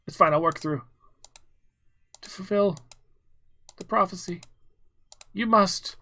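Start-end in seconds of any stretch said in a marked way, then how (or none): 2.2-5.9 high, eerie ghostly voice; ghostly spirit. Acted. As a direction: moan the prophecy like a spirit. Ghost voice resumes.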